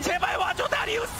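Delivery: high, loud begging yell; desperate shout. Real, not acted. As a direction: scream it at the screen. Caster begs Darius loudly.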